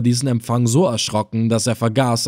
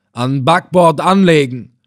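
loud, raised order to the boat; guard's command; 4 semitones higher, 6 dB louder than usual. Acted. Guard calling down to the approaching boat, firm loud order. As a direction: call the order down firmly.

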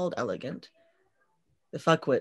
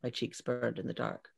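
usual speaking voice

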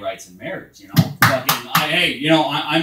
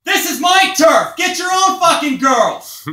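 slow, bellowing territorial yell, much higher and louder; furious shout; 11 dB louder, slower than usual. Retold or imitated. Comic retelling a fight, yelling at the fleeing guy. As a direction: roar it like a guy defending his turf. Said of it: "I'm all".

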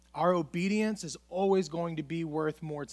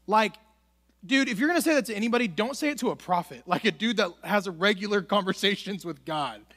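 normal talking voice

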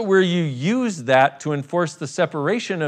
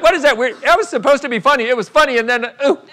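higher, louder imitation of offended listeners; mock judgmental listener; 7 semitones higher, 4 dB louder than usual. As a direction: mimic someone taken aback, pitched up. Voices listeners sliding into self-judgment.

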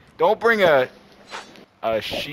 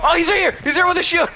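loud and high, alarmed; excited shout; 12 semitones higher, 3 dB louder, faster than usual. Real, not acted. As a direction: shout the warning. Spotting an enemy.

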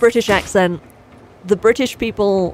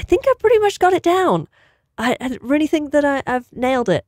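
high-pitched mock-earnest student; put-on hopeful actor's voice; prank theater student; 7 semitones higher than usual. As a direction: play the proud accent learner, pitched up. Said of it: "really learn the British accent".

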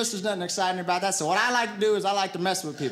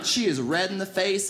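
ordinary talk

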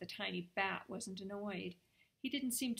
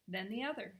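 ordinary delivery

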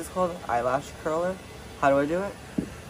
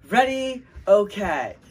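cheerleader call; 4 semitones higher, slower than usual. Pretend. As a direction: shout it like a cheerleader. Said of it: "Make up a cheer".

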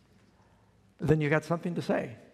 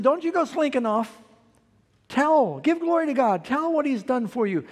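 urgent command; 9 semitones higher, 5 dB louder than usual. Retold or imitated. Preacher voicing Jesus's urgent command.

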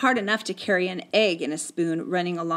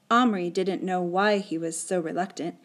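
ordinary delivery

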